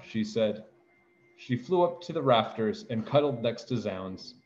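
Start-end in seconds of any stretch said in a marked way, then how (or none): none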